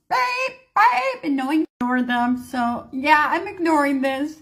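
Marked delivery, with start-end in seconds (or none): 0.1-1.2 high, drawn-out alarm beeps; imitated phone alert. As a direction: mimic a phone's emergency alert tone. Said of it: "how the phone goes off when the Amber Alert comes".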